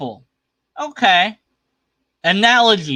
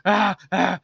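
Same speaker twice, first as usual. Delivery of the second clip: high-pitched, hammed-up frantic yelling; put-on hysterical outburst; mock yelling; 5 semitones higher than usual. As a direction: imitate someone yelling, too worked up to stop. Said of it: "they're still yelling at each other".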